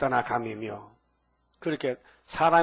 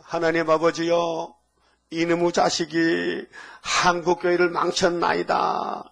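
slow, earnest prayer voice with 한국교회를 leaned on; repentant prayer; 3 dB louder, slower than usual. Retold or imitated. Pastor quoting a friend's Easter prayer, slow solemn confession. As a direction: pray it out slowly as a public confession. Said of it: "이게 이제 기도".

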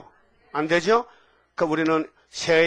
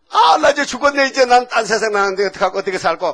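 high, loud, comic crying lament; mock newborn wail; 7 semitones higher, 9 dB louder than usual. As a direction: wail it out, sliding gradually down in pitch. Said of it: "하고 우잖아". (갓난아기 울듯 과장해서 크게 한탄)

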